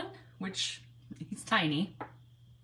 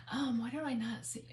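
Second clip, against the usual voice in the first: 1 whispered aside; 9 dB quieter than usual. Real, not acted. Muttering to herself at screen.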